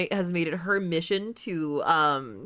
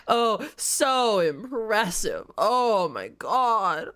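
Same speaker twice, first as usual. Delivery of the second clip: sarcastic awe; 3 semitones higher, 3 dB louder, much slower than usual. Real, not acted. Sarcastic mock praise.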